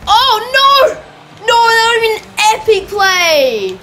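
very high, loud, drawn-out wail; anguished gamer cry. Real, not acted. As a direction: cry out in dismay, stretching the words. Near miss in game.

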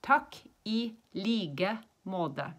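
very slow, "like" with a G for K, "måte" with a D for T. Shown as a demonstration. Teacher answering nice to meet you, slowly, G in "like", D in "måte". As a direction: soften "like" to a G sound and "måte" to a D. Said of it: "we say more of a D".

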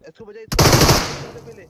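high urgent yell; frantic shout. Real, not acted. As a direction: yell in a panic to save him. Urgent save teammate.

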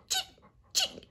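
very high cheep; cheeping squirrel. Acted. Teacher voicing Squirrel, very high cheep. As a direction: chirp it tiny and high. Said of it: "said Squirrel".

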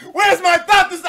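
mock frantic shout; loud frantic search. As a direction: yell it as the search gets urgent. Mock searching, getting louder.

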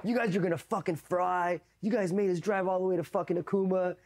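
high-pitched sergeant scolding; imitated chewing-out. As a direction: play a sergeant tearing into his men. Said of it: "they're fucking chewing us out".

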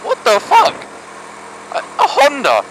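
high squeal of disbelief; sudden high-pitched what the; shocked disbelief. Real, not acted. Disbelief at Honda breaking.

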